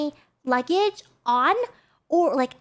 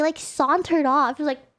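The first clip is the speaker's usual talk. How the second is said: ordinary delivery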